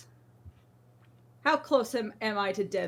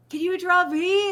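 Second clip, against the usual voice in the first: higher, louder eager request in a put-on voice; imitating an eager trainer; 8 semitones higher, 8 dB louder than usual. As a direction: play the trainer begging for a drawing. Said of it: "It's like".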